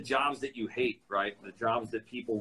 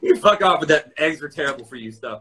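loud rant; 8 dB louder than usual. Real, not acted. Ranting against anti-egg claims.

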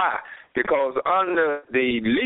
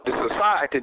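usual speaking voice

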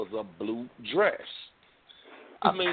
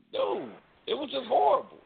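dismayed friend; 10 semitones higher than usual. Retold or imitated. Retold reaction in story.

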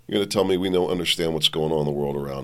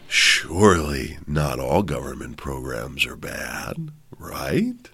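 lower, slow, coaxing scorpion voice; sly scorpion; 6 semitones lower, slower than usual. Acted. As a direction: slowly sweet-talk like a scheming scorpion. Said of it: "the scorpion came along and said".

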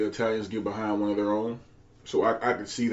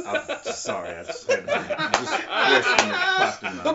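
laughing. Real, not acted. Laughing apology.

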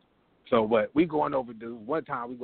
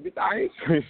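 indignant; slower than usual. Retold or imitated. Startled reply to psychic woman.

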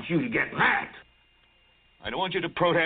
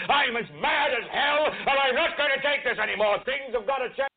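yell; 7 semitones higher than usual. Acted. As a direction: bellow it into the street. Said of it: "stick your head out and yell".

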